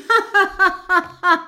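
high, loud ghostly laugh; high laughter. A narrator's reading. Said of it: "bricht in schallendes Gelächter aus". (hohes, lautes Lachen)

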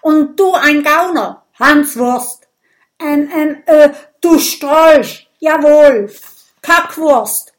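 loud, high voice, slowing on du Strolch; shouted insults. Acted. A duelist hurling insults, loud and high. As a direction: bellow the insults triumphantly.

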